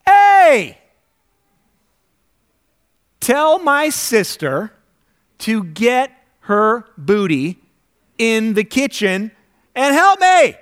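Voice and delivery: high, loud, slow drawn-out demand; hammed-up bossy yell, stretching each word; mock yell at Jesus. Martha yelling at Jesus about her sister, loud and slow. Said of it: "she's yelling at him".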